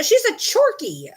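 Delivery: high, drawn-out mock seller voice; imitated sellers. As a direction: imitate them in a high, slow pitch. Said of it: "they said".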